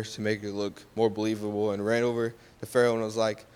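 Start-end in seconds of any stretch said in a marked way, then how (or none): none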